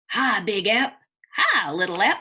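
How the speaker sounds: a lightly voiced cheery hello; cheerful greeting. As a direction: call out hello like a little brother. Brothers greet at the store.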